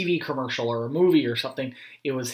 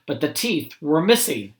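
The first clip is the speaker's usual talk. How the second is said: ordinary delivery, nothing marked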